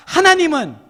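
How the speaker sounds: loud, measured proclamation; call to repeat. Shown as a demonstration. Preacher leading the congregation to repeat after him, loud proclamation. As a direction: project each phrase for a crowd to echo. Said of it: "따라해봅시다".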